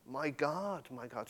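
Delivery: soft, falling away on the second call; hushed quoted cry. Retold or imitated. Preacher quotes Jesus's cry to congregation, hushed.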